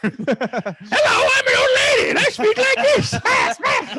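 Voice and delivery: high, very loud, drawn-out screech; cartoon old lady. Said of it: "people have the best cartoon voices".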